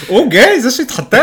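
high squeal of fake shock; mock surprise. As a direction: gasp it out in playful disbelief.

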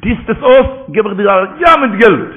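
high, loud, pressing command; insistent order. Acted. Grandfather offering his grandson money, loud and insistent.

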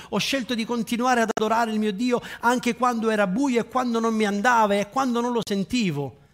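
climbing into a passionate testimony; fervent preaching. Acted. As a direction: preach it, rising with every quando. Testimony preached at full force.

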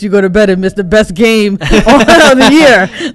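laughing tease. Real, not acted. Teasing co-host who missed the game, laughing. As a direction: rib them, laughing through the end.

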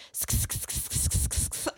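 breathy repeated sk hissing sounds; sksksk hiss. Retold or imitated. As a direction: hiss sk sk sk like a teen trend. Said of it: "all they responded with was just".

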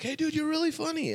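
high-pitched mock fan compliment; admiring fan. Dude at a concert praising the comic, admiring.

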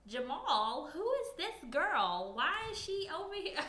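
put-on high, whiny girlfriend confronting him.

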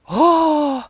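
high, loud thrilled gasp; delighted. A narrator's reading. Taputi thrilled to meet the ghost, high delighted exclamation. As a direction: burst out in delight. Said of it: "se riemastui".

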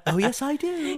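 prim British computer voice; female British accent. Pretend. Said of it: "I do a nice female British accent".

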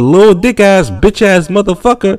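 hammed-up screaming wife; mock angry insults. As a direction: spit the insults out like a furious wife.

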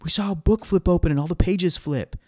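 high-pitched child witness, imitated; excited kid. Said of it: "one kid was like".

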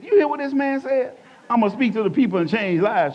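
high and loud, bursting to tell; can't contain the news; a husband eagerly telling his wife what the man said; excited exclaim. Retold or imitated.

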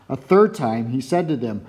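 plain reading voice

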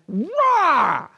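high wordless burst of anger; hammed-up enraged "rah"; mock rage roar. Spouse made mad just rages, mock roar. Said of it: "You just rage".

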